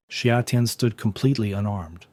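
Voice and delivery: usual speaking voice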